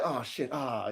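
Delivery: low, soft self-reproach; hushed, deflated aside; muted realization. Acted. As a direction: say it quietly to yourself as it sinks in. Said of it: "you be like".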